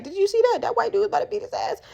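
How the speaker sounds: high, quick excited bar chatter; excited gossip. Retold or imitated. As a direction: gush it like a thrilled onlooker.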